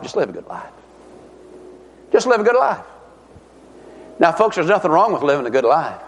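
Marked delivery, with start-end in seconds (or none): 0.0-0.7 much lower and softer, coaxing devil; sly devil whisper. Retold or imitated. Devil coaxing the listener, low and soft. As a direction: murmur it low like a tempter. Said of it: "The old devil will tell you".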